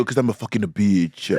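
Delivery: imitated drag queen's Japanese accent; mock Japanese-accented comeback; thick Japanese accent. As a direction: mimic her Japanese accent on the punchline. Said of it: "she had a, a very Japanese accent".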